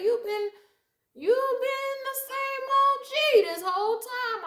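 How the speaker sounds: high, slow sing-song praise; approving friend. Retold or imitated. Telling an old friend he stayed true, high and slow. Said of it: "Like, okay".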